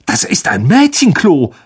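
"Das ist ein Mädchenklo" in shocked disbelief; scandalised prefect; appalled. Acted. Percy to Ron, appalled at the girls' toilet. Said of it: "sagte er entsetzt".

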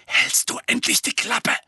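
sharp hiss under the breath. A narrator's reading. Harry hisses at Dudley to shut up. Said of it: "zischte Harry".

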